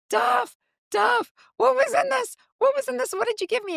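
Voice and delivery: high, urgent mock panic; pitched far up, desperate for an answer; frantic questioning. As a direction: call out to him in a panicked squeal, retold. Retold frantic questioning of bandmate.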